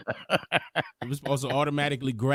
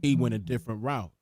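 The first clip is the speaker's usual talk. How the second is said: narrator voice; 7 dB louder than usual. Shown as a demonstration. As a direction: read it like a documentary narrator. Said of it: "do the narrator voice".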